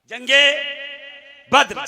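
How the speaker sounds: जंगे at full voice, left hanging; projected prompt. Real, not acted. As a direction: call out जंगे with a rising end.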